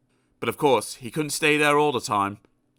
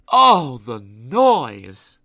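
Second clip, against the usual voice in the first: slow, booming cry of "Oh, the noise!"; loud exclamation; 8 dB louder, much slower than usual. A narrator's reading. Narrator exclaims about Loudland.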